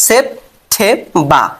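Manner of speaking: সেপ্টেম্বর very slow, p plain and unaspirated; সেপ্টেম্বর slow, syllable by syllable; slow syllable demo. Shown as a demonstration. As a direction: spell out সেপ্টেম্বর slowly, with no puff on the p. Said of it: "প".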